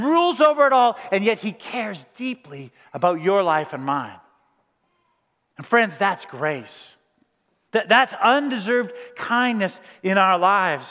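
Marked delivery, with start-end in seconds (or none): 5.6-6.9 slow and lower; tender emphasis. Real, not acted. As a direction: pastor naming grace to the congregation, slow. Sermon's key point.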